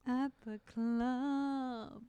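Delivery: very slow, stretched-out words; lingering, playful interjection; drawn-out joking. Pretend. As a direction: draw out the last word playfully.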